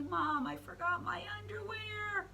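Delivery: put-on high small boy-frog voice; Froggy's voice. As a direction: squeak it out like an embarrassed kid.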